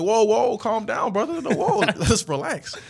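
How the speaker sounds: a hammed-up, laughing plea to back off; mock alarmed calming. To a teammate who kicked his shoes, high laughing calm-down.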